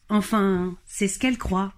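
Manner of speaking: ordinary delivery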